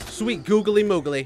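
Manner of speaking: ordinary talk, nothing marked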